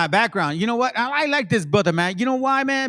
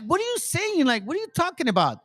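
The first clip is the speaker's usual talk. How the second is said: high, disbelieving what-are-you-saying; incredulous; 10 semitones higher, faster than usual. Retold or imitated. Retelling his reaction to the caseworker, high and incredulous. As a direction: throw the question back in shock. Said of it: "'Cause I was like".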